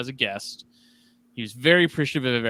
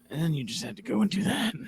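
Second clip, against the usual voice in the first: higher-pitched mock exasperation; mock-hurt complaint; 8 semitones higher, 4 dB quieter than usual. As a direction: complain like a jilted pal, voice pitched up. Mock-hurt complaint to absent guest.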